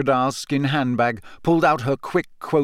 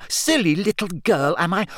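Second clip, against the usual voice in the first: higher, scornful repeat of the insult; mocking echo; 6 semitones higher than usual. A narrator's reading. Hermione bitterly mimicking Rita's insult. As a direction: throw her words back with scorn. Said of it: "She was shaking with rage".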